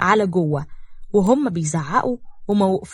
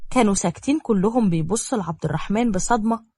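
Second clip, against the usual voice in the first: ordinary delivery, nothing marked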